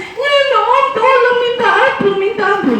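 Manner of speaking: very high sobbing plea for mercy; begging and crying. Acted. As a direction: beg the thug for mercy, crying. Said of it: "So menangis".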